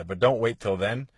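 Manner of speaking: usual speaking voice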